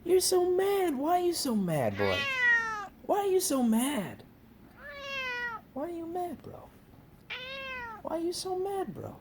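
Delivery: very high, singsong pet-talk voice; high-pitched teasing. Real, not acted. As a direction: tease a pet in a squeaky-high voice. Teasing the jealous cat.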